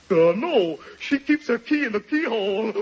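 low, slightly dim character voice; simple woodcutter. Acted. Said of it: "the woodcutter's a simple man".

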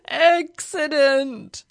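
drawn-out loud groan of dread. A narrator's reading. Nervous driver groans as he starts the engine, groaning. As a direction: groan it out long, high and loud. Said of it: "he groaned as he started".

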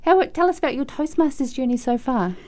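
normal talking voice

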